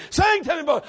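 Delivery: put-on drunk father calling high; demanding drunk daddy; town drunk. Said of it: "And he'd say".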